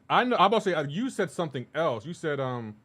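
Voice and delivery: usual speaking voice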